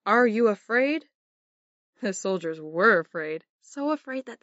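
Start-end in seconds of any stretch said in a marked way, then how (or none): 0.1-1.1 loud mocking taunt; giant taunting shout. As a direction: bellow it like a giant. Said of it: "Goliath shouted".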